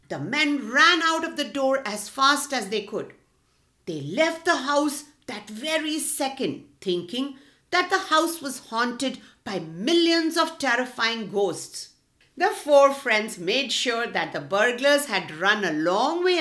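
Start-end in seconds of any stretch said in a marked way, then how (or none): none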